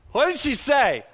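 high, loud demand to the crowd; insistent question. Real, not acted. Preacher repeats question, building up.

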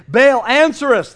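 loud, high cry to a god; shouted plea. A narrator's reading. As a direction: call out slowly and loudly for an answer. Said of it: "They shouted".

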